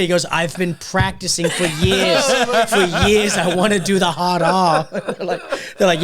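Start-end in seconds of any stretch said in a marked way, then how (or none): 0.3-4.9 loud, drawn-out Boston "years"; Boston accent. Retold or imitated. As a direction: mimic an earnest Boston guy, loud and slow. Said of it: "you can't pronounce R's because of".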